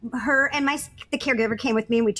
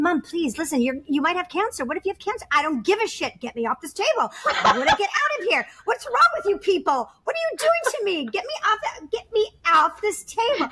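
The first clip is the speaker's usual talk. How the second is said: mock mother; 7 semitones higher than usual. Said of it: "I'm going".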